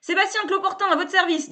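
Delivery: loud, high, cheerful bluster; brash salesman. A narrator's reading. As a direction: barge in like an overfamiliar hustler. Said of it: "dit Sébastien".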